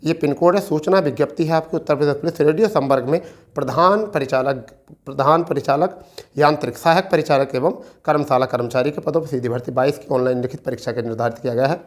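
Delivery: normal talking voice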